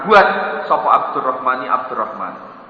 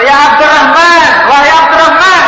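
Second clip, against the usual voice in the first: much louder and higher shouted call; calling out angrily; 11 semitones higher, 9 dB louder than usual. Acted. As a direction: bellow the name across the house. Abu Bakar memanggil dengan marah. (Abu Bakar berteriak memanggil Abdurrahman)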